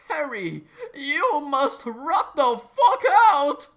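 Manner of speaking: loud, slow, high yell through a laugh; Dumbledore calling out. Acted. Dumbledore calls Harry from the moon base, laughing yell. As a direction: bellow it, barely holding back laughter.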